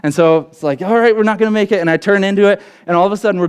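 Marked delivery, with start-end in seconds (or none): 0.8-1.8 higher, fast, urgent call; bracing everyone for impact; urgent decision. Retold or imitated. Driver deciding to go down the hill, higher and fast.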